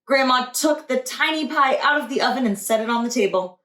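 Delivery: usual speaking voice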